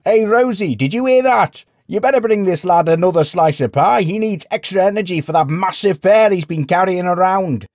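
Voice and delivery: high, loud, jeering shout; calling out. A narrator's reading. Villager calls to innkeeper mocking the lad, loud and high. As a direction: holler the joke across the room.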